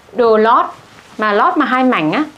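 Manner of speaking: ordinary talk, nothing marked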